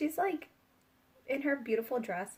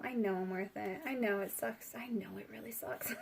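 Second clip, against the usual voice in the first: soft, smug mock reply; 6 dB quieter than usual. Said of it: "She's like".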